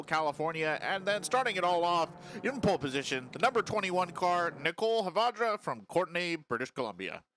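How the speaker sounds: steady announcer cadence reading the starting order; grid announcer read. Acted. Race host reading the field rundown, announcer style. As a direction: call out each driver like a race PA announcer.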